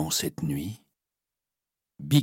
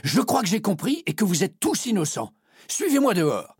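much higher, eager cry; excited exclamation. A narrator's reading. Gaston announcing the solution, excited cry. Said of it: "Gaston s'écrie".

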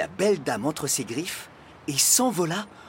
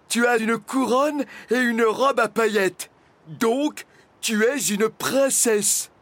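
high, loud, self-important dragon; stubborn dragon; 10 semitones higher, 5 dB louder than usual. A narrator's reading. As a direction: state it stubbornly, like an obstinate child. (dragon têtu, voix haute et forte)

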